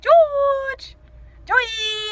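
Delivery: very high, loud shriek. Acted. Shrieking to her friend, high and loud. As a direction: shriek the names out.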